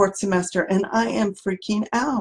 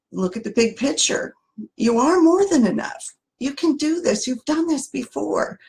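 high, bright pep talk from a wise friend; encouraging wise friend; 6 semitones higher than usual. Acted. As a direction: lift the voice high to cheer them on. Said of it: "What would your wise friend say?".

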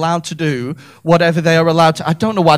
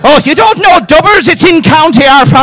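high, loud, hammed-up Dublin accent; 7 semitones higher, 7 dB louder than usual. Comic mocks a local explaining Dubbers, loud Dublin accent. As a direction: put on an exaggerated Dublin local, pitched up. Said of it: "I've done Dublin".

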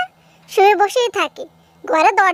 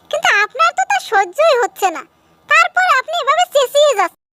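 angry retort; 7 semitones higher than usual. Acted. Angry reply to mother-in-law.

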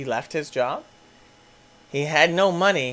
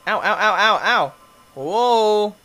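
ordinary talk, nothing marked

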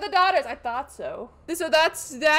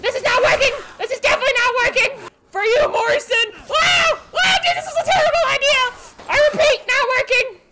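high, loud laughing shouts; laughing yell; 8 semitones higher, 3 dB louder than usual. Real, not acted. Gamer's knife plan failing, laughing yell. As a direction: yell in giddy panic.

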